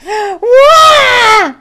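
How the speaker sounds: high, loud crybaby wail; mock wail. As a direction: bawl like a scared townsman. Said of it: "one person gets up and goes".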